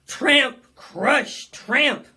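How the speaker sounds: loud stomping sound words; big heavy tramp, crush, tramp; stomping elephant. A narrator's reading. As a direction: boom each word like a giant foot.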